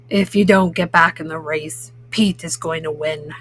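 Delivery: deep, grumpy toad warning. Acted. Toad warning turtle about losing the race, low and grumpy. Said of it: "says Grumpy Toad".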